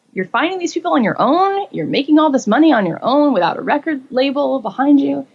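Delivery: high, excited praise; admiring. Retold or imitated. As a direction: gush with admiration. Artists praising her.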